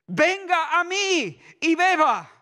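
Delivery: calling out slowly to everyone who thirsts; Jesus' cry. Acted. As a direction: cry it out to the crowd at the feast. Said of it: "con mi grito".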